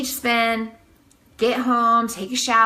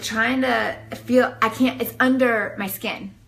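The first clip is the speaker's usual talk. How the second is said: ordinary delivery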